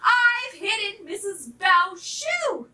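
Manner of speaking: high, drawn-out proud announcement; boastful little pup, high-voiced; excited pup's cry. A narrator's reading. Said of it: "wee Peter Pug cried".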